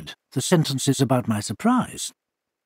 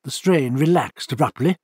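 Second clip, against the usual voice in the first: ordinary delivery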